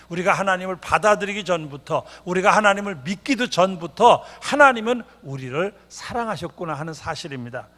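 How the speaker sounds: ordinary delivery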